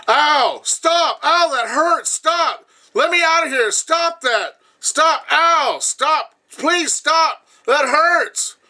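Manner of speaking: high-pitched, loud pained screaming; imitating her screams. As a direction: scream like a woman being hurt and trapped. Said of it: "there's this screaming, right, of Katana".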